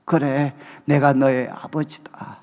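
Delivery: 그래, 내가 너의 아버지다 said slowly, pitched low; voice of God. Retold or imitated. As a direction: speak as God, slow and deep. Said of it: "하나님께서 저에게 말씀하셨어요".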